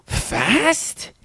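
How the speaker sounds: high, rising amazed little voice; gasp, then high rising Fast; toddler gasp and question. Retold or imitated. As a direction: imitate a wide-eyed toddler.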